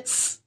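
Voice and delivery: "س" as a bare, sharp sss; wrong hissing "س" in place of th; unacceptable hissed sound. Shown as a demonstration. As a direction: let "س" whistle as a pure sibilant. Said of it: "یہ sound بالکل قابل برداشت نہیں".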